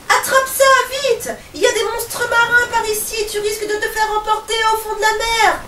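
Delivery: very high, loud urgent calls; captain calling to his drowning crewman; urgent shout. Acted. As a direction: yell across the waves. Captain throwing a buoy, urgent.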